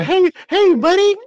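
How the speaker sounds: put-on very high little kid voice; child voice. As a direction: squeak like a tiny child. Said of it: "She is a".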